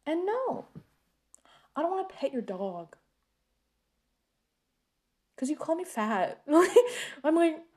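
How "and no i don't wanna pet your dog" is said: ordinary talk, nothing marked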